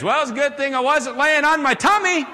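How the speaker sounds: high, loud Arkansas twang; comic high-voiced Arkansas friend; Paul. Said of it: "my friend Paul could say is".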